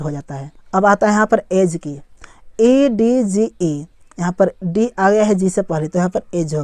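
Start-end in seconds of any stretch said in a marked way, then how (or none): 2.6-3.9 very slow, separated letters; one letter at a time; spelling it out. Shown as a demonstration. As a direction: spell it slowly for learners. Spelling a word aloud for learners.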